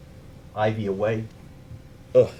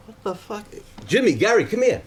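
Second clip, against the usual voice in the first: startled call; 11 semitones higher than usual. Retold or imitated. Panicked at swarm of flies.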